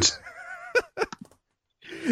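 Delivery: high-pitched, laughing through the words; helpless laugh pushing the voice up; real laughter. Real, not acted. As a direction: squeeze the words out while cracking up. Cracking up over press release.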